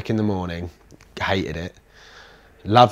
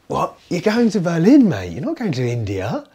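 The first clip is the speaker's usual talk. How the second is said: incredulous friend; 9 semitones higher, 6 dB louder than usual. Retold or imitated. Friend's incredulous reaction retold.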